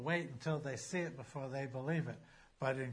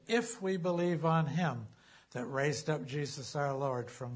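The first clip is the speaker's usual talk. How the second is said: louder, declaiming Bible reading; scripture reading; 6 dB louder than usual. Acted. Pastor reads Romans aloud to the congregation, preaching proclamation. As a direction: proclaim the verse to the whole room.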